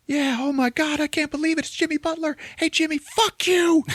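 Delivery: very high squealing fan voice; hammed-up high fanboy squeal; starstruck. Rob Corddry starstruck by celebrity.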